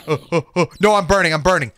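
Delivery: ordinary delivery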